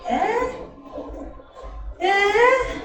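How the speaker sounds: high, drawn-out mocking "eh"; mock questioning. Brothers reacting to sister's changed speech, mock questioning. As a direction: pitch it right up, teasing.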